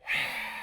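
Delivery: soft, airy exhale; sigh of relief. Acted. Berry finally off the bush, relieved sigh. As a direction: let the breath out after all that pulling.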